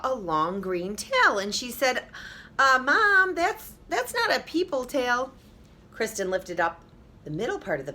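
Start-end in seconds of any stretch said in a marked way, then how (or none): 2.6-5.3 put-on high, doubtful little girl; girl telling Mom something is wrong; puzzled girl voice. A narrator's reading.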